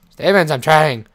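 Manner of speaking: loud, fed-up plea; exasperated protest. Pretend. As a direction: protest loudly to nagging pets. Protesting to complaining animals.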